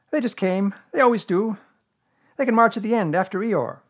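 high, loud offhand animal voice; careless. A narrator's reading. Rabbit explaining his friends and relations, careless. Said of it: "explained Rabbit carelessly".

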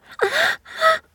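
sudden high, loud gasp; a shocked gasp that jumps up; sharp gasp. Acted. Lover suddenly overwhelmed, sharp high gasp.

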